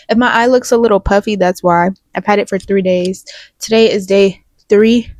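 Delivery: normal talking voice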